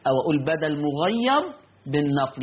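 usual speaking voice

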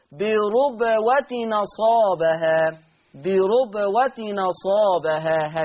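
بربوة نصابها very slow, ra with damma, tanween carried as nun; تلاوة ورش ببطء; Warsh naql recitation. Shown as a demonstration. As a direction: draw out بربوة نصابها slowly. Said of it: "هكذا".

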